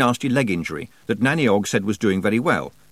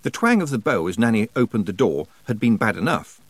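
ordinary delivery

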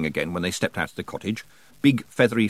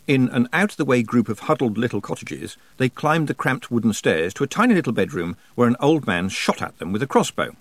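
usual speaking voice

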